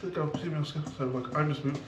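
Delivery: ordinary talk, nothing marked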